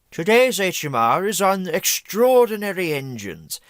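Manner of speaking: ordinary talk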